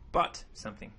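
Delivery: ordinary talk, nothing marked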